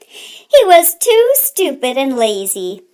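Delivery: plain reading voice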